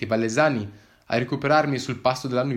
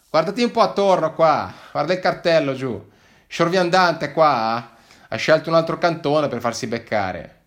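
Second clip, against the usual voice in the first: higher, louder agent voice; amused agent; 5 semitones higher, 7 dB louder than usual. Acted. Agent shows De Martino the border sign, amused. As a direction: point it out with amusement.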